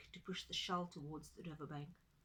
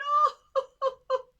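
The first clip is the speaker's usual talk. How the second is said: very high, loud mock sobbing; tortoise bawling, pitched way up; hammed-up wail; 19 dB louder than usual. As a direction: wail it out over the top. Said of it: "Tutu began to cry".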